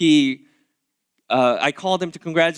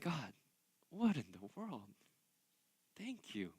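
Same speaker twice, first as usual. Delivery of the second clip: a near-whispered, awed prayer, much softer; hushed awe; 4 semitones lower, 22 dB quieter than usual. Retold or imitated. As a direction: breathe it to God in wonder. Quoting his own awed prayer.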